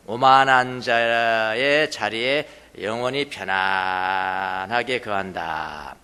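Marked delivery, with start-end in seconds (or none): 2.8-6.0 low, slow, lingering vowels; drawn-out comfort. Shown as a demonstration. Preacher describes dwelling in the proud one's seat, slow and low. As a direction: stretch it out as if settling in for good.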